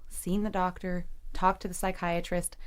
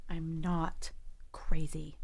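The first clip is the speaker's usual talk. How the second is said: slow breathy whisper, almost unvoiced; whispered to herself; 3 semitones lower, 5 dB quieter, slower than usual. [name] alone at the sink, secretly whispering. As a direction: confess it under the breath, alone.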